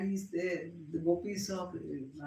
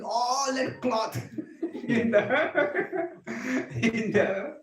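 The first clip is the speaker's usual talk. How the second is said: loud, real laughter breaking into the words; cracking up mid-story; laughing while telling; 6 semitones higher, 7 dB louder, much slower than usual. Real, not acted. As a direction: try to continue through laughter.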